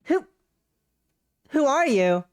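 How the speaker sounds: loud, high, startled stammer; startled girl. Acted. Shocked girl asking her rescuers who they are, startled.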